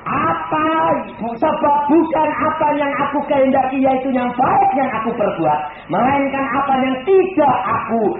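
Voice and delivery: loud, fervent sermon voice; full-force preaching. Acted. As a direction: preach it hard to the congregation. (khotbah berapi-api)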